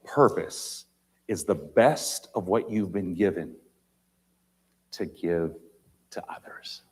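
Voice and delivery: with long pauses, trailing to a whisper; slow, dropping to a whisper on "to others"; slow and deliberate. Shown as a demonstration. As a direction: let each phrase sink in, fading out. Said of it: "Listen carefully".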